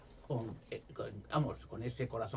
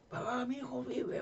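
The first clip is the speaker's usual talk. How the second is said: mucho más aguda y lenta, voz de mujer; madre emocionada; 12 semitones higher, slower than usual. Retold or imitated. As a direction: imita a la madre que no se lo cree. Madre celebra al hijo vivo. (madre emocionada, voz muy aguda)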